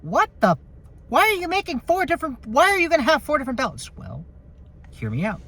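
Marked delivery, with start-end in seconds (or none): 0.0-3.9 high, fast, mock-baffled voice; squeaky what-the-heck disbelief; incredulous critic. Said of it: "people will go".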